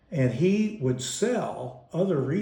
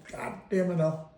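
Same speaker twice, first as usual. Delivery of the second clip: scolding mom; 6 semitones higher than usual. Retold or imitated. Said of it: "That's what she called him".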